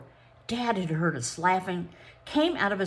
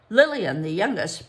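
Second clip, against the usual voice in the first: ordinary delivery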